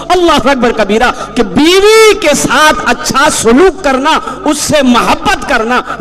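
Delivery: high, ringing call to marry; soaring sermon cry; fervent preaching. Real, not acted. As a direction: preach it out with full fire. Preaching at full force.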